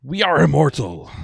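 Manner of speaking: high, loud, rousing pirate toast; triumphant captain's battle cry; pirate lord's toast. Acted. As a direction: proclaim it to the whole crew, building to the last words. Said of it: "he's like".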